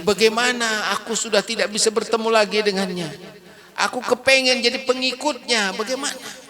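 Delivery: high, lightly voiced pleading; pleading old man. Old man begging to become a follower, high voiced. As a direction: ask it high and longing.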